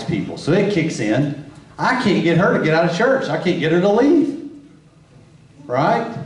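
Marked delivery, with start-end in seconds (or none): none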